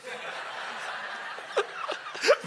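high breathy laugh. Real, not acted. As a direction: burst out laughing. Laughing at his own story.

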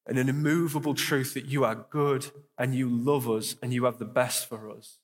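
earnest congregational prayer voice; spoken prayer. Acted. Pastor praying over the room, earnest and steady. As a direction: pray aloud for the room, heartfelt.

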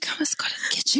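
secretive whisper; whispered invitation to the kitchen. Retold or imitated. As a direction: pull her aside in a hush.

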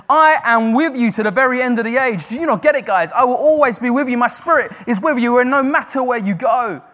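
loud, high impassioned cry; screaming God's promise. Acted. Preacher shouting God's promise at his listeners, loud and high. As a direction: shout the promise over them. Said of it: "And he's screaming at us today".

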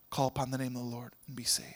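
a gentle whispered invitation; hushed question. Retold or imitated. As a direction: lean in and whisper it. Intimate question to Philo.

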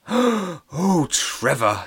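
high, breathy swoon; teacher Terry swooning over Trevor; admiring teacher. Acted. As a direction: gush with admiration.

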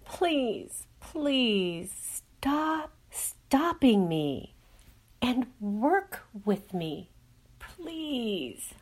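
breathy slow whispered plea; pleading as Clacy. Acted. Clacy pleading with her mind, slow whispered begging. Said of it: "pleaded Clacy".

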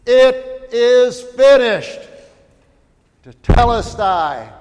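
loud, high, slow cry of victory; full-voiced, triumphant proclamation; joyful shout. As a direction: cry it out in great joy. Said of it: "to shout out in great joy".

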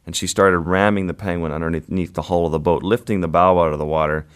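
ordinary delivery

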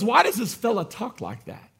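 mildly condescending teachers of the law; talking down. Acted. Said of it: "you're talking down when you call someone a fella".

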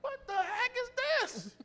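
high, put-on voice of a baffled parent; exasperated parent. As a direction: squeak it out like a fed-up mom. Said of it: "Parents was like".

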